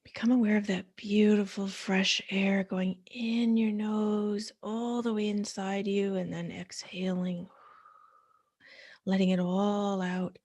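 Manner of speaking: soothing guided meditation voice. Acted. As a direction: ease the listener into stillness, one breath cue at a time.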